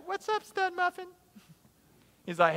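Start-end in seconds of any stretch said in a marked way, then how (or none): 0.1-1.1 put-on high, airy girl-cub voice, much higher pitch; mock-sweet high voice for stud muffin.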